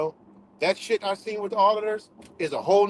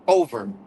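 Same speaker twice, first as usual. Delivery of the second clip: much lower, heavy "Over" landing like a verdict; low final verdict; 10 semitones lower, 4 dB louder than usual. Real, not acted. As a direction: drop low on "Over" to close it. Final word after spelling.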